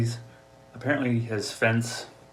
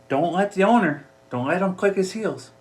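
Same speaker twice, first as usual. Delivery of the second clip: higher, louder urgent boss; urgent warning; 8 semitones higher, 5 dB louder than usual. Pretend. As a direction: press the warning home.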